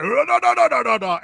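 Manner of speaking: high, loud garbled villain noises; mock unintelligible villain. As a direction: mimic a villain who can't be understood. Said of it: "The guy that says nothing that's, uh, you know, intelligible".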